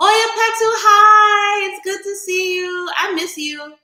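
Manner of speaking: high, sing-song hello to a friend; excited greeting. Real, not acted. Greeting Oya Petu in the live chat, high and sing-song. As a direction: greet her like a long-lost sister, up high. Greeting a viewer joining the stream.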